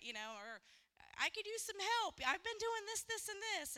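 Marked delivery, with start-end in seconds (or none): 1.2-3.8 much higher, louder mock exasperation; hammed-up listing of chores done; exasperated complaint. Husband complaining he does everything, mock exasperated and high. Acted quarrel over chores.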